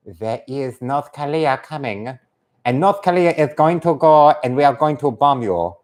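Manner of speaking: deliberate mock North Korean accent; stilted Korean-accented announcement. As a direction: make threats in a caricatured Korean accent. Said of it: "do the Korean voice like this".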